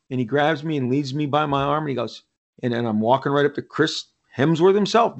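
ordinary talk

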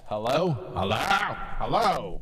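calling out. Pretend. Calling into malfunctioning equipment.

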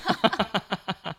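high loud laugh; burst of laughter. Real, not acted. Laughing at being told to cut.

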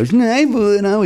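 "Stifel" said high and pointed, rhyming with stifle; mock smug Stifel. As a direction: pronounce "Stifel" like stifle, mocking. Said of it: "some asshole would have done that".

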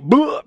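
sudden mock retching "bleh"; imitated projectile vomit. Friend retelling the leper vomiting on Eddie, mock puke noise. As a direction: blurt out a loud cartoon puke noise. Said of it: "projectile vomits right in his face".

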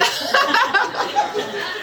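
big fake laugh to cue the audience; hammed-up high laugh at her own joke; forced laugh. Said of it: "Laugh, people".

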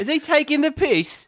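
very high fake English bloke; cartoonish Brit asking if he's joking; mock British accent. Cohost riffing on the bit, high mock British accent. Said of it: "absolutely shitty British accent".

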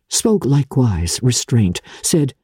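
plain reading voice

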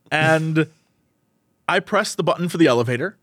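normal talking voice

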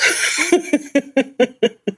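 high giggling laugh; bright laugh. Real, not acted. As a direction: laugh it off lightly. Laughs after nervous remark.

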